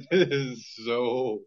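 slow, laughing self-criticism. Real, not acted. Host judging own bad accent, laughing slowly. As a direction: drag it out through laughter.

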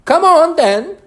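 high, very loud summons; roared. A narrator's reading. The Queen roars at Alice to join. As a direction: roar the order. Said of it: "roared the queen".

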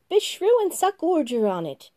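high, loud little curse from April; April yelps a curse at the hot juice; April's burned curse. Acted.

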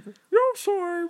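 very high, drawn-out gringo Spanish; mock an American accent in Spanish, way overdone; exaggerated gringo accent. People in Chile mimicking me, exaggerated gringo accent. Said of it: "really exaggerated gringo accent, imitating me and stuff".

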